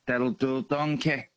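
lightly voiced cartoon ogre; a hint of the movie ogre; Shrek impression. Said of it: "I can actually do".

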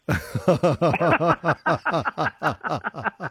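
long, high cackling laugh; real cackle. Real, not acted. Host still cackling at a child's bath story. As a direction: cackle helplessly at the joke. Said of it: "I'm still cackling at that".

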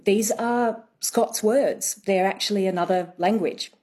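usual speaking voice

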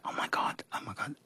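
fast, soft oh my God repeated; panicked murmur. Retold or imitated. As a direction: mutter it in a breathless rush. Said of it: "I was like".

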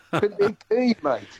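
ordinary talk, nothing marked

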